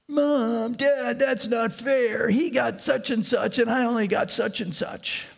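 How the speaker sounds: put-on whiny child voice, high pitched; nasal whine. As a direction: whine like a jealous kid. Children complaining on Christmas morning.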